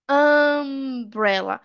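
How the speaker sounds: "Umbrella" very drawn out, long "uh" first. Shown as a demonstration. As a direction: stretch the opening vowel of "Umbrella". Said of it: "o som da letra U".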